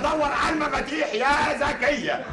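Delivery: high pitched, drawn-out exasperation; exasperated. Acted. Doctor pleads with the maid, exasperated. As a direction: beg her to stop talking.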